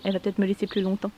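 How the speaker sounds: normal talking voice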